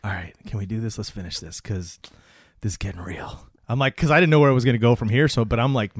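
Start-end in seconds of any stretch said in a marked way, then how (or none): 0.0-3.5 low, soft self-talk; retold attempt to hold it together; quietly gather yourself, low; composing oneself. Retells composing self after tearing up.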